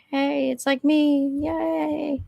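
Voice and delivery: slow, drawn-out little yay; soft, stretched-out fangirl greeting. Acted. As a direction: cheer quietly for yourself, slowly. Mimics her low-key delight at likeness.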